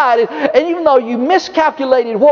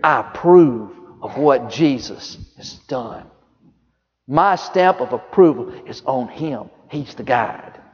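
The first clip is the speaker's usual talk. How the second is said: low, soft, solemn voice of God; God the Father's voice; 9 semitones lower, 5 dB quieter than usual. Retold or imitated. Preacher voicing God's approval of Jesus, low and hushed. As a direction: speak it deep and hushed, like heaven approving. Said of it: "But what he did say".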